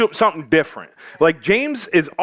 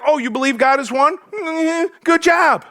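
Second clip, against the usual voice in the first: higher, mock-impressed good job; sarcastic mock praise; sarcastic James; 8 semitones higher than usual. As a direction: pat him on the head sarcastically. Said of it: "James is like".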